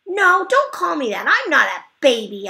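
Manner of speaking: high, loud yell. Acted. Junie B yells at Polly not to call her a baby, loud and high. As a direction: shout it like an insulted kid. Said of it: "I yelled".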